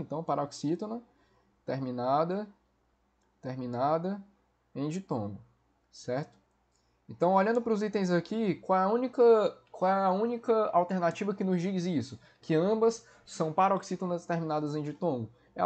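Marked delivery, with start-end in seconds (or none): none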